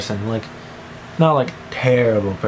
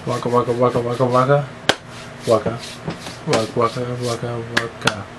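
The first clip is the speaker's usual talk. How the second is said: nonsense chant; 3 semitones lower, 4 dB quieter than usual. Pretend. Nonsense chant scrolling Facebook.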